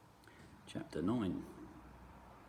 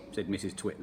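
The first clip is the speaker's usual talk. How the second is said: plain reading voice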